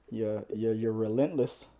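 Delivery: normal talking voice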